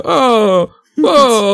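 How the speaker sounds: high, drawn-out hammed-up wail; mock a star overdoing the comedy; Jared Leto. Reminding friends of Jared Leto's comedy, hammed-up impression. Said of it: "Jared Leto qui essaie de faire la comédie".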